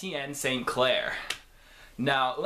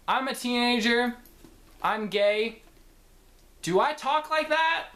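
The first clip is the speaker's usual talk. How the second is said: mocking teen voice; 10 semitones higher than usual. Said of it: "people don't talk like that".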